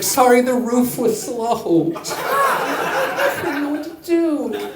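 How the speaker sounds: high, slow, timid apology; pitifully sheepish witness; meek excuse. Retold or imitated. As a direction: squeak it out feebly. Director's meek answer.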